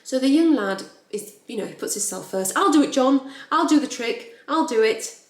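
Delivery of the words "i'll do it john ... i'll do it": loud, higher voice of an eager volunteer; acted showing-off, pitched up and loud; showing-off lad. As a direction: play a cocky lad putting himself forward. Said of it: "showing off in front of the lads".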